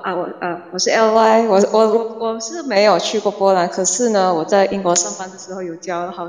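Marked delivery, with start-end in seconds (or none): none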